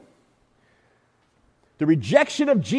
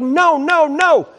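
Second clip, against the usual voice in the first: higher, insistent no, no; mock stubborn refusal; 8 semitones higher, 3 dB louder, much slower than usual. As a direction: dig in and refuse. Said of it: "Saul was like".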